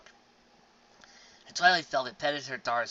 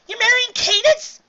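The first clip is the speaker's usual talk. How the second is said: even higher, slow, loud delighted squeal; ecstatic squeal; 17 dB louder than usual. Acted. Sister squealing at her brother's news, ecstatic. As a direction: stretch it out in pure delight.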